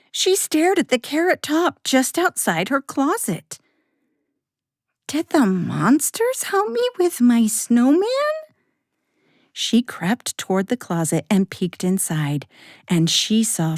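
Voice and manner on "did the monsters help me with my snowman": put-on high, childlike wondering question; high young girl asking in wonder; little girl voice. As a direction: voice Sarah as a curious little girl. Child Sarah voiced in story.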